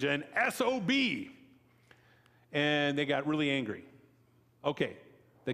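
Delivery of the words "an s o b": high, slow, spelled-out jab; lightly acted floor insult; mock insult punchline. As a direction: draw out the letters for effect.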